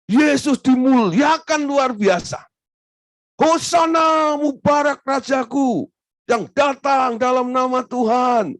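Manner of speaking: high, loud triumphant proclamation; jubilant preaching. Acted. Preacher voicing the crowd's hosanna, jubilant and loud. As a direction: shout the hosanna like the cheering crowd.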